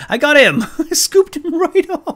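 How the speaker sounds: loud, high triumphant laugh; excited, laughing. Real, not acted. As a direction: shriek with gleeful triumph. Catches the seagull.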